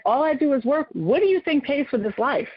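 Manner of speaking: a working mom snapping back at her daughter; pissed retort. Retold or imitated. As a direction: defend yourself in hurt anger. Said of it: "Now I'm pissed".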